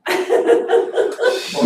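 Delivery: bursting, breathy laugh; high, loud burst of laughter. Real, not acted. Amused at the other speaker's words, laughing out loud.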